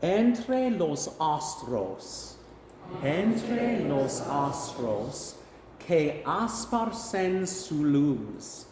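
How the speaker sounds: Spanish phrases spoken slowly, syllable by syllable; slow Spanish pronunciation model. Shown as a demonstration. Choir director saying Spanish phrases to repeat, slow pronunciation model. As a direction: stretch each Spanish syllable for learners to copy. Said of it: "I'll say it first and then you can repeat after me".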